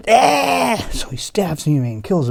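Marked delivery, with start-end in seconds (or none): 0.1-0.9 high loud death cry; loud high dying yelp. Acted. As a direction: cough and cry out. Mime stabbed.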